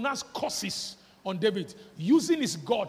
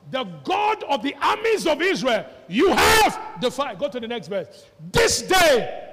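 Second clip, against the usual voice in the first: loud, high shout, slow on "defied"; David shouting defiance at Goliath; battle cry; 3 semitones higher, 11 dB louder than usual. Acted. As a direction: roar the challenge at the giant.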